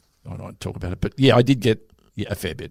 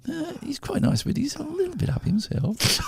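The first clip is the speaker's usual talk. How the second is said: put-on higher, softer gossiping girl voice; mock-girly toilet gossip, pitched up; 6 dB quieter than usual. Girls in the toilet gossiping about the date, high girly voice. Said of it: "they're going".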